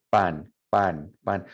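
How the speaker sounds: pan with an unaspirated Spanish P. Shown as a demonstration. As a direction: say each "pan" with no air on the p. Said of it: "there should be no air coming out".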